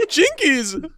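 a light go at Velma; Velma catchphrase. Acted. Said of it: "that's Velma".

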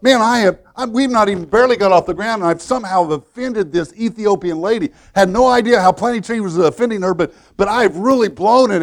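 high, loud and fast, a man sure he blew it; retold panic. Preacher voicing a missionary's panic on the plane, loud and rushed. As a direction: rush it out like you just offended a stranger.

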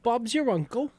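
hammed-up British phrase; bad British accent; mock British accent. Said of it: "My accent sucks".

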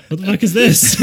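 loud, high cry of disbelief; laughing, incredulous retold shout; baffled outburst. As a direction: yell it in hammed-up bewilderment. Retells pulling a cat out on leash.

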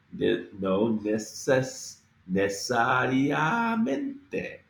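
necesariamente said slowly, each syllable worked through. Shown as a demonstration. Teacher working through necesariamente slowly for learners. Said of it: "We have to get through this whole".

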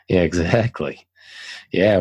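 normal talking voice

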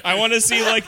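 usual speaking voice

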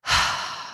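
loud weary sigh; heavy tired exhale; mom sigh. Real, not acted. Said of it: "I'm just sighing so loud".

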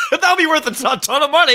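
high, breaking into laughter; cracking up mid-sentence; laughing. Real, not acted. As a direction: talk through real laughter. Cracking up at the riff.

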